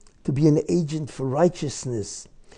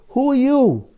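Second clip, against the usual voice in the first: indignant outburst; 10 semitones higher, 8 dB louder than usual. Real, not acted. Preacher rebukes Trump's promise.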